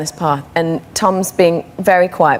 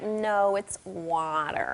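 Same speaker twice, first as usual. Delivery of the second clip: "water" as American "WAH-der"; child insisting on a drawn-out American "water"; American accent; 15 dB quieter, much slower than usual. Retold or imitated. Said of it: "And she was like".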